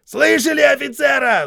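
high, loud bellow; shouting drill sergeant. A narrator's reading. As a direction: bellow at the ranks. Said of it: "завопил Хейксвилл". (вопящий сержант)

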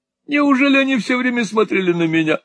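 high-pitched foppish astonishment; vain affected husband. A narrator's reading. Vain husband hears of admirers, high astonishment. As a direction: gush it high, marveling at oneself.

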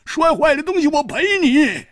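high-pitched protesting yell; agitated shouting. Acted. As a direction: demand release in a high strained voice. Customer shouting to be released.

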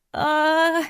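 high excited squeal; girlish squeal. Acted. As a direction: squeal with pure delight. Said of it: "завизжала девчонка".

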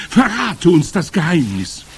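high, loud pleading from the crowd; impatient calling. A narrator's reading. Eager witches pressing their leader for the secret, impatient calling. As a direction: demand it impatiently at high pitch. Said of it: "rief die Menge ungeduldig".

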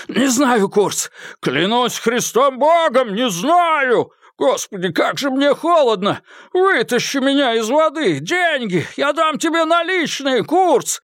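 high, frantic pleading voice; desperate frozen man. Acted. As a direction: beg for life, freezing in the river. Malcolm begs from icy water. (отчаянная мольба замерзающего)

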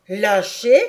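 loud, indignant outburst; an angry farmer's shout; angry cry. Acted. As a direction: snap it back furiously. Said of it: "cria Reb avec colère".

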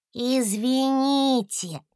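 high, slow and polite; a small dragon calling out politely; polite little dragon. A narrator's reading. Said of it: "вежливо обратился Гусля".

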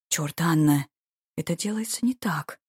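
plain reading voice

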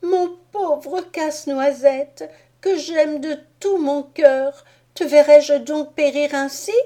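put-on a little girl begging through tears; tearful girl. A narrator's reading. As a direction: Marie weeps over the dying nutcracker. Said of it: "s'écria-t-elle en sanglotant".

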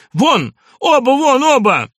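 high, loud order to get out; explode, voice leaping up in fury; frantic shout. A narrator's reading. Doctor throwing both visitors out, frantic shout. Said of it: "крикнул он не своим голосом".

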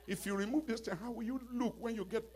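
high-pitched pep talk to oneself; mock self-encouragement. Voices self-talk against crying.

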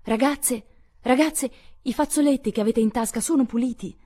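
high, loud call from a window; mother calling her daughters from the window. A narrator's reading. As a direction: call after the girls leaving. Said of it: "una voce chiamò dalla finestra".

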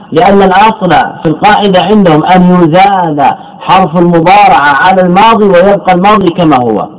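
normal talking voice

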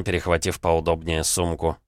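ordinary delivery

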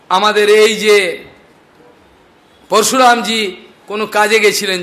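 ordinary delivery

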